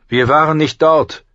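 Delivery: high, forceful knightly retort; proud knight. Acted. Kingsguard knight answering Ned at their confrontation, proudly defiant. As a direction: answer loud and high, standing firm.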